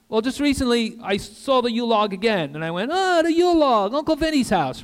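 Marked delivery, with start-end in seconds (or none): 2.9-4.8 high, loud nostalgic exclamation; delighted recognition. Retold or imitated. Preacher recalling seeing the Yule log, exclaiming with delight. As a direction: re-enact a burst of happy recognition, pitched way up.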